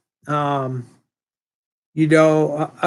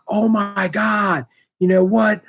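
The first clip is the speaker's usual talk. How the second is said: put-on higher, slow astonished gasps of a kid; imitating excited kid; 4 semitones higher than usual. Student learns his teacher's brother is famous, imitated freaking out. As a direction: act out a stunned student losing it. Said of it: "And he's, like, freaking out".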